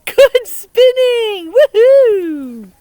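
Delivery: high, loud, stretched-out cheer; excited cheer. Real, not acted. Teacher cheering kids after spinning, loud and high. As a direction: cheer them on with big joy. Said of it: "cheering the children's spinning".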